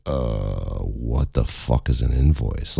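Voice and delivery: a baffled mumble, as if the question makes no sense; dumbfounded. Retold or imitated. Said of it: "I kinda went".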